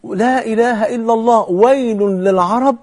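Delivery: high, measured recital of an alarmed cry; solemn alarmed warning. Retold or imitated. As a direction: stretch each word like a grave warning. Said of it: "فدخل النبي فزعا وهو يقول".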